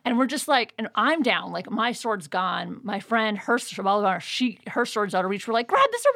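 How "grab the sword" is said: high, loud urgent call; shrill urgent battle shout; excited yell. Retold or imitated. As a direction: yell at a friend to grab it. Urging friend in fight.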